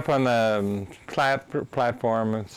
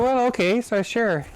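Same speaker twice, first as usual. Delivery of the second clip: surprised agreement; 13 semitones higher than usual. Retold or imitated. His own stunned reply.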